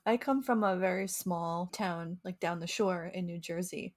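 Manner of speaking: normal talking voice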